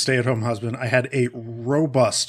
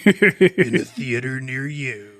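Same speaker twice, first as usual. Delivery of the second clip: laughing through an epic trailer; movie trailer voice; much slower than usual. Pretend.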